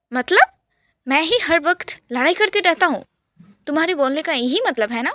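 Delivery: loud, sharp, offended protest; indignant outburst. Acted. Yash snapping at his brother-in-law, indignant and loud. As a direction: snap back at him, offended.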